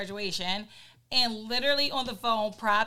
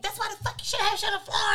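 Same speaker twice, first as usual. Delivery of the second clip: higher, fast scolding; 11 semitones higher, 4 dB louder, faster than usual. Retold or imitated. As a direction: scold him for leaving mess. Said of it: "And I'm like".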